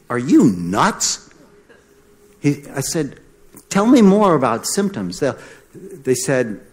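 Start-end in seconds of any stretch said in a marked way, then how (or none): 0.1-1.3 slow, disbelieving retort; incredulous. Retold or imitated. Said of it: "I'm like". 3.7-5.2 higher and louder, pressing demand; irritated demand. Retold or imitated. Angry doctor challenging colleague.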